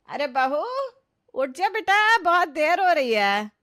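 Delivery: high, loud wake-up call. Acted. Mother-in-law knocks to wake late-sleeping bride, calling out.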